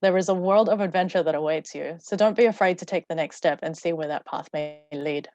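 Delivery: ordinary talk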